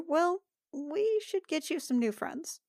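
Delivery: usual speaking voice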